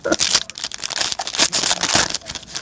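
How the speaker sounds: suppressed breathy laugh; stifled laugh. Real, not acted. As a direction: try hard to hold in a laugh. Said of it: "I'm trying not to laugh".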